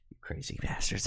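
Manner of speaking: affectionate whispered insult; a soft whispered jab at the fans. Real, not acted. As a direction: hiss it fondly under your breath. Fond insult to his viewers.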